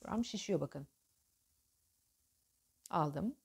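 normal talking voice

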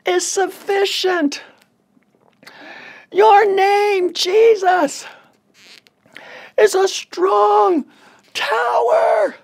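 very high, slow, loud cry; stretched-out high scream to God; screamed prayer. Retold or imitated. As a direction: holler the promise out in long drawn phrases. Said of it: "I'm screaming".

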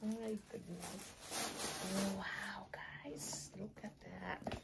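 soft awed whisper. Real, not acted. Admiring the bag for viewers, whispered.

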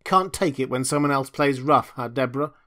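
loud, mocking taunt; taunting Deborah with a loud sneer. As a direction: sneer it at her. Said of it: "I sneered".

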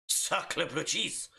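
slow, drawn-out sacre bleu; hammed-up Frenchman, very slowly; mock French.

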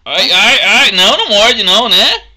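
loud panicky cries of ai; scared yelp. Real, not acted. Friend yelping loudly as the ferret nips her.

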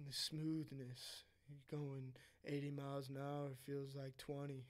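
imitating a cool, soothing voice, slow and soft; low-key, drawn-out car commercial impression; Matthew McConaughey. Co-host mimicking the actor driving in a Lincoln commercial, soft and slow. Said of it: "it's his voice".

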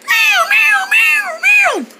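high repeated siren wail; shrieking bird distress call, like a fire alarm; alarm-like bird cry. Acted. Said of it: "It sounded like a fire alarm".

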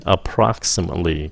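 approximately stressed on PROX; second-syllable stress. Shown as a demonstration. Teacher shows where the stress falls, second-syllable stress. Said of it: "Cái âm nhấn là cái âm thứ nhì".

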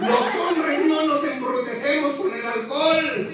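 laughing shout. Real, not acted. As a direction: call it out with a grin. Emcee shouts statement to crowd.

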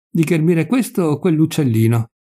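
ordinary delivery

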